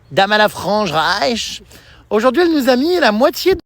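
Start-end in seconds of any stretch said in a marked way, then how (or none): 0.1-1.6 drawn-out, slow mock announcement; slow mock title. Daughter teasing mother, slowly announcing a mock title. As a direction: spell it out slowly like a museum caption.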